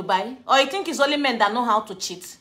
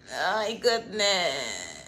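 slow sigh; 5 semitones lower, 7 dB quieter, much slower than usual. Real, not acted. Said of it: "repeated exclamation".

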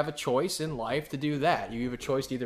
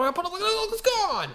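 squealing high, hammed-up amazement; door-to-door salesman wowing at his magic trick; salesman hype; 5 dB louder than usual. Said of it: "one of those guys knock on your door".

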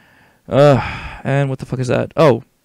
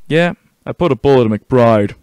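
louder, steady game-character delivery; in-character report; 7 dB louder than usual. Acted. Soldier reporting to colonel, firm in-character voice.

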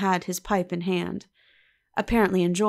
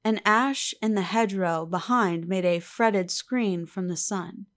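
plain reading voice

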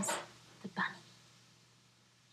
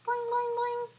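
high boing sounds. Acted. Flea bouncing sound.

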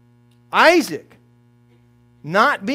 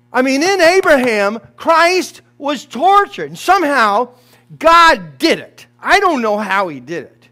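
fervent preaching; 4 semitones higher, 6 dB louder than usual. Real, not acted. Preacher builds to climax.

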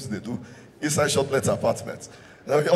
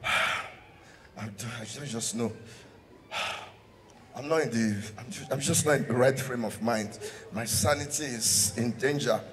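sighing, softer whiny excuse; mock moody girl; 4 semitones lower, 6 dB quieter than usual. Girlfriend dodging her man, in a mock sulky sigh.

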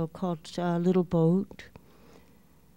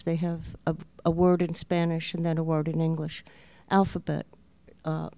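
ordinary talk

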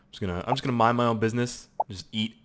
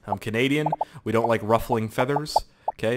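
ordinary talk, nothing marked

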